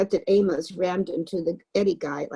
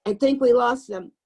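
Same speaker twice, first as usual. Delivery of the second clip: loud, higher call while running; yelled relief; 5 semitones higher, 5 dB louder than usual. A narrator's reading. A runner yells back to the group in the dark, loud relieved call. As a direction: call out that they're safe. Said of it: "someone yelled".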